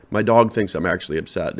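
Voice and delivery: usual speaking voice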